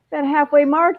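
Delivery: normal talking voice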